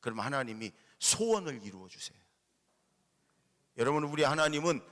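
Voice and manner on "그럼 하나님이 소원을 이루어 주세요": ordinary talk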